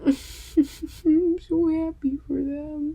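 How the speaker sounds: real happy tears; teary happy. Real, not acted. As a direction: laugh through tears of joy. Moved by the Ian and Mickey scene.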